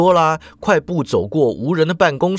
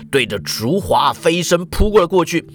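usual speaking voice

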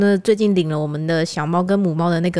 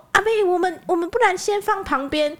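nervous pleading; 9 semitones higher than usual. Retold or imitated. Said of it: "我就说".

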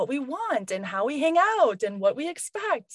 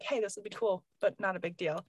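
softer, lower offhand request; casual low-stakes ask; 6 semitones lower, 8 dB quieter than usual. Shown as a demonstration. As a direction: toss it out as no big deal. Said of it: "how you ask when you're like".

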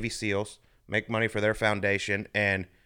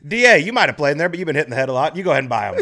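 mock auction call; 4 semitones higher, 10 dB louder, faster than usual. Mock bidding call to friend.

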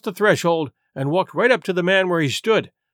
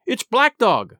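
higher-pitched cry of alarm; 5 semitones higher, slower than usual. A narrator's reading. Boy identifying the fleeing man, crying out. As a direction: call the name out urgently. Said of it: "I cried".

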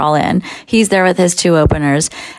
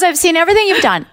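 rushed, high gushing; eager fan; 9 semitones higher, faster than usual. Retold or imitated. Jerry telling the comic he follows her, rushed gushing. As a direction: imitate a fan tumbling over the words. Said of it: "He goes".

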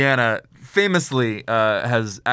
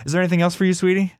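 higher, sugary cashier voice calling you sweetie; 8 semitones higher, much faster than usual. Retold or imitated. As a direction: play the cashier sweet and chirpy. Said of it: "the cashier says".

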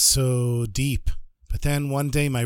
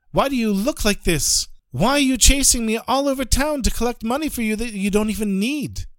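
higher, pressing questions in disbelief; bewildered pleading; 6 semitones higher than usual. Acted. Rabbi confronting Ephraim over the trick, higher and pressing. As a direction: demand to know why he was tricked. Rabbi confused, demanding answers.

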